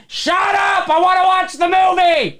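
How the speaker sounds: heckler shout. Retold or imitated. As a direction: yell at the announcer from the seats. Audience heckling the announcer.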